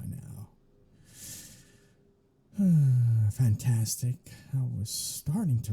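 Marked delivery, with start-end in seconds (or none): 2.6-3.3 big loud exasperated exhale; loud weary sigh. Acted. Vampire learning the house is lost, loud sigh. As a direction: heave a heavy sigh of resignation.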